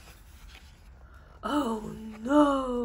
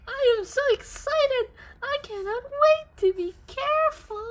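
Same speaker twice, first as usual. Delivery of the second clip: squealing high and loud with glee; excited; 13 semitones higher, 6 dB louder, faster than usual. Acted. Hog as Piggy squealing to Harold with glee. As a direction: bounce with giddy excitement.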